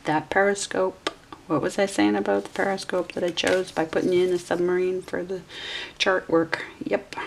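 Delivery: ordinary talk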